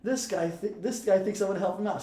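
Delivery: high-pitched mock holler to colleagues; retold yelling. Guard to cops way back in the cage, mock yell. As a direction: imitate a guard calling out, pitched well up. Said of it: "she yells to cops way back".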